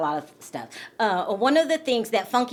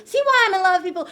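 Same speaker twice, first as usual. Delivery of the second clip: gushing; 9 semitones higher, 6 dB louder, faster than usual. Real, not acted. Gushing to viewers again.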